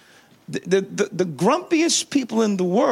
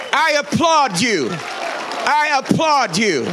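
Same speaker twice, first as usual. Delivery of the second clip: emphatic declaration; 6 semitones higher, slower than usual. Shown as a demonstration. Pastor urging the congregation to applaud each other, emphatic. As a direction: proclaim it, stretching the repeat. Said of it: "Say it, say it".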